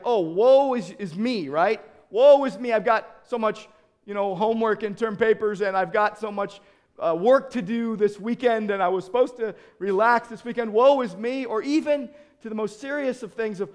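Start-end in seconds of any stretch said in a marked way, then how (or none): 0.1-11.5 loud, put-on woe-is-me lament; mock self-pity. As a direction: complain loudly in mock despair. Mock self-pitying complaint about workload.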